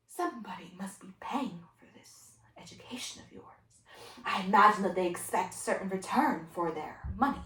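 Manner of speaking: hushed, measured teacher; exasperated teacher. Acted. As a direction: reason quietly with a difficult student. Teacher reproves student.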